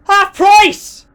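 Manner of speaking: put-on outraged high yell in an Irish accent. Irish organiser hearing about half price, outraged yell. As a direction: shriek it in Irish disbelief. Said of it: "yelled the Irish organiser".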